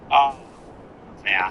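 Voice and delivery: usual speaking voice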